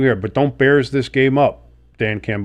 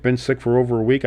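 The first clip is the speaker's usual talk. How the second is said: normal talking voice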